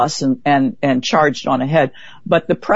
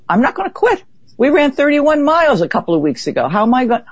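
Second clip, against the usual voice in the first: higher, fierce determination; defiant vow; 9 semitones higher than usual. Retold or imitated. Telling Arnie she will finish, higher defiant insistence. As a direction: insist you will not quit, pitch up.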